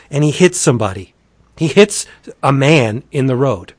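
ordinary talk

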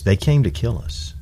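usual speaking voice

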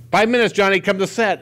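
high, loud set call. Retold or imitated. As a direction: shout it through the trailer door. Calling Johnny to set.

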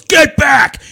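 loud, high shouted order at a squirrel; mock commanding shout. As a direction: imitate a recruit yelling a command. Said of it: "points at the squirrel, goes".

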